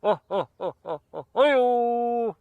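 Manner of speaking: high, loud, slow drawn-out laughing "oh oh oh"; hammed-up impression of a chuckling sidekick; Ed McMahon laugh. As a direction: stretch each oh like a sidekick's belly laugh. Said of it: "He's like Ed McMahon".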